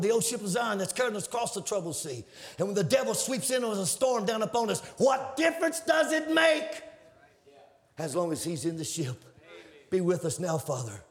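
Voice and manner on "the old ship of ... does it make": rising preaching. Acted. Preaching climax.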